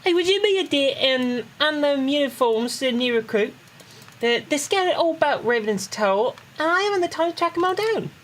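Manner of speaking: high-pitched Cockney character voice; Tataru. Acted. Player reads Tataru's recruit orders to a friend, high Cockney voice. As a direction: put on a Cockney accent, pitched well up. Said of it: "you want me to do a Tataru Cockney accent".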